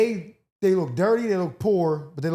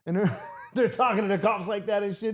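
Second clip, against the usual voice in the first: ordinary talk, nothing marked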